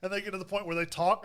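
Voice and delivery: usual speaking voice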